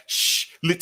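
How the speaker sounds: unvoiced shush; mock whisper; whispered hush. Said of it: "he like".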